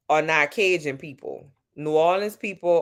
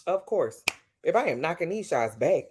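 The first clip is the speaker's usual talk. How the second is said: usual speaking voice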